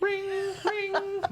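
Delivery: high, sung ring-ring; ringing bell imitation. A narrator's reading. Narrator imitates the office bell, high singsong. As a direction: chirp it like a bell.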